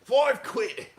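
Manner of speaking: loud, exaggerated British accent. Joking about British money, loud British accent. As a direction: bellow it in a broad British accent.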